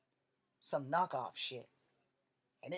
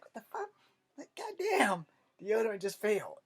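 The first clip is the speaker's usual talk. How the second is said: much higher, incredulous yelp; exasperated outburst; 6 semitones higher, 4 dB louder than usual. Retold or imitated. Schoolboy smelling his armpit fail, incredulous yelp. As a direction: shriek in disbelief.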